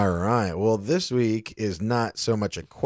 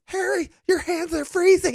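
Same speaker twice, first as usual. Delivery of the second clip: high, laughing movie quote; Dumb and Dumber impression. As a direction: squeak it out like the character. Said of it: "Dumb and Dumber when he's like".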